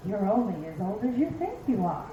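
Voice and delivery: low, coaxing inner voice; the brain talking, pitched well down. Acted. Brain sweet-talking its aging owner, low inner voice. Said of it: "your brain will say shit like".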